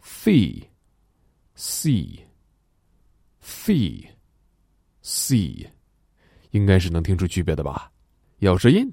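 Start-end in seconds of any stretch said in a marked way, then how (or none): 0.0-5.7 Thie with tongue between teeth, see with a hissed s; slow drilled Thie against see; th versus s contrast. Shown as a demonstration. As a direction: bite the tongue for Thie, then hiss see. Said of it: "我们只发the和C".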